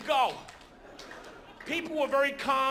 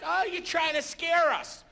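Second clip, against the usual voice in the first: mocking passengers; 7 semitones higher than usual. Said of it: "They're like".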